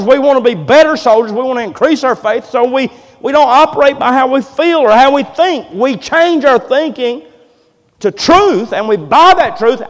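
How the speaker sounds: loud, rising preacher's cry; preaching at full force. Acted. Pastor rallying his church, preaching loud and hard. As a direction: drive the point home to the congregation. Preaching builds on soldiers of faith.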